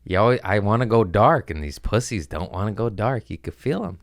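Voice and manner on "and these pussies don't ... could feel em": normal talking voice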